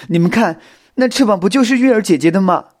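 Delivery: high, loud tearful outburst; crying. A narrator's reading. Xiao Xin pointing at the broken wing, crying. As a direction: wail it as if in tears. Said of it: "小新指着翅膀哭着说道".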